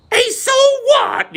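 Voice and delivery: squeaky, very high, slow mock Australian accent; bad Australian accent, shrieked slowly and high; failed Australian accent. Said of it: "I can't do the fucking Australian accent".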